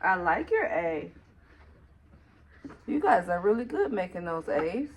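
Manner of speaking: ordinary talk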